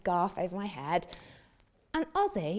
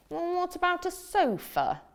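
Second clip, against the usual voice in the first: hopeful frog; 9 semitones higher than usual. Acted. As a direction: ask brightly hoping for yes. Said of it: "said the frog".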